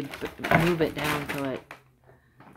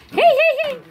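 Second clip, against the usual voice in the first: calling out; 13 dB louder than usual. Real, not acted. Calling out to puppies.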